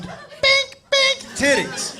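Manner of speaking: high squeaky bink bink, playful; bouncy bink noise. Shown as a demonstration. Comic demo for the crowd, squeaky bink noises. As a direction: bounce the word bink up high, then drop. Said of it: "I don't think you're getting the right visual".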